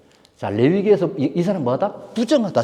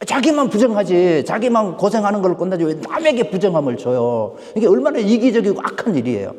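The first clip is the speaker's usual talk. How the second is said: louder, higher indignant preaching; preaching at full force; 6 semitones higher, 4 dB louder than usual. Real, not acted. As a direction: press the point with rising indignation. (힘주어 설교하듯)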